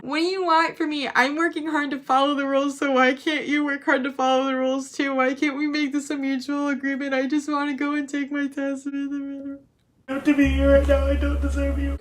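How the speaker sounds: high, whiny mock rant; hammed-up fed-up student; exasperated outburst. Retold exasperated outburst at teacher.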